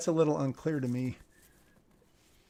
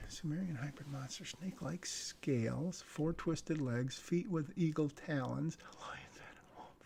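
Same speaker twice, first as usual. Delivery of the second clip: softer and softer, ending in a whisper; hushed read; 9 dB quieter than usual. Acted. Reviewer reads the creepy monster description in a hush.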